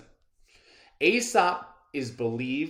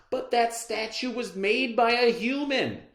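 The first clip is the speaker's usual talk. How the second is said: higher and louder retort; cried out; 10 semitones higher, 4 dB louder than usual. Acted. The lion answering the man about the statue, loud high retort. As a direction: fire back at the man. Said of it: "he cried".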